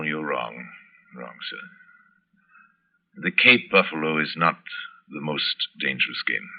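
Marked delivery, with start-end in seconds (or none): 0.0-3.2 breathed, soft and much lower; whispered correction. Acted. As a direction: hiss the correction under the breath. Zaroff contradicts Rainsford.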